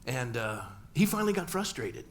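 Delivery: usual speaking voice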